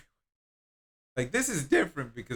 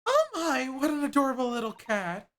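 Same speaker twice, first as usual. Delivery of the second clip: higher, animated character voices; two game characters bickering, voiced higher; voiced game dialogue; 12 semitones higher, 3 dB louder than usual.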